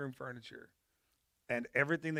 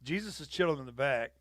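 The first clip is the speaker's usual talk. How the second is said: usual speaking voice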